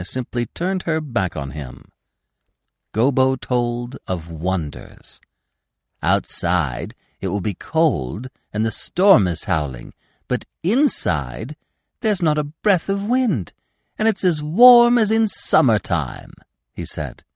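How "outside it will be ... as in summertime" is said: put-on young deer voice. Gobo telling of wonders.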